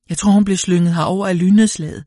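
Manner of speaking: a shaken witness at the lightning scene, frightened and fast; shaky voice. A narrator's reading. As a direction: blurt it out in shock. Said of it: "sagde kvinden med rystende stemme".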